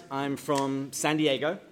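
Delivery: usual speaking voice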